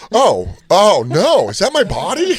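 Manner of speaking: high, slow horrified cry; mock-shocked at own body; horrified gasp. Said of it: "And I go".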